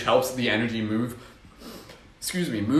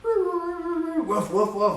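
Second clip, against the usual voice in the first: high yelping woofs; wolf voice; much slower than usual. Shown as a demonstration. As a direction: bark like a playful wolf. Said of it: "a wolf howl".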